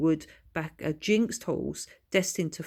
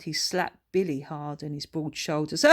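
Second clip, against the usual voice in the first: ordinary delivery, nothing marked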